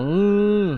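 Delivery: high, loud muffled moan through closed lips; strained moan. Retold or imitated. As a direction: moan as if you cannot open your mouth. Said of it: "mga ganun lang ako".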